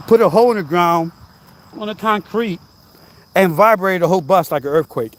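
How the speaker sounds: ordinary talk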